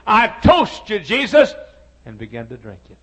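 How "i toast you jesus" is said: loud, high, mocking toast with a laugh; a swaggering barroom toast to Jesus; drunk man's toast. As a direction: raise the glass and crow it out, laughing.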